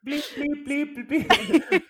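very high repeated bleeps; imitated censor bleep. Covering a friend's risky remark, high loud mock bleeps.

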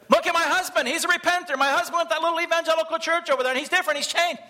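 wife shouting her husband's news to the neighbours, mock yell; retold yelling. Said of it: "I'd start yelling".

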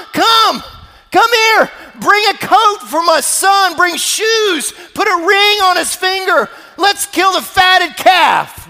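loud, high, jubilant calling out; joyful shout. Acted. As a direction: yell for the servants in joy. Father yells for his servants.

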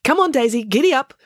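high, loud call to gallop; urging a mount. Acted. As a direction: spur the animal on excitedly. Loretta urges on water buffalo.

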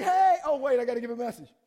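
joking shout-out to the TV; mock excited shout. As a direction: yell it like waving to the camera. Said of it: "I'm like".

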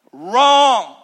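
loud, drawn-out Wrong with falling tune; loud firm denial; emphatic wrong. Real, not acted. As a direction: slam the word down. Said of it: "emphatic rebuttal".

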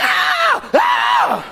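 two high falsetto shrieks; hammed-up comic screaming; dramatic mock screams. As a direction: scream like an overdramatic moviegoer. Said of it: "We're dramatic".

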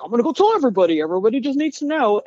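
high eager rush; excited. Retold or imitated. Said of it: "I was like".

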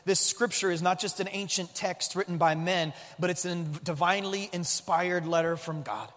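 hushed, earnest prayer to God. Acted. Pastor praying before the sermon, hushed and earnest. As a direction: pray softly over the congregation.

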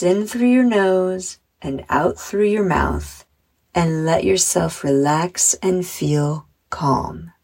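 measured meditation voice with long pauses; unhurried guided relaxation, phrase by phrase. Acted. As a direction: guide children gently through breathing and imagery. Leads a guided visualization.

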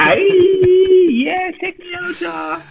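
very high squealed whoop; playful hype cheer. Pretend. Guest cheers the plug of their work, high playful whoop. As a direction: squeal it like your own hype crew.

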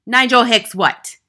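very loud, high shout; furious headmistress roar; bellowed demand. A narrator's reading. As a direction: bellow it at the little chap. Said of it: "the Trunchbull bellowed".

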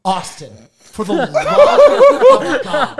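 high, loud, drawn-out appeal; exasperated plea. Retold or imitated. Ignored newcomer begging a streamer to listen, exasperated.

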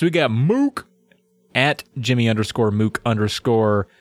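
usual speaking voice

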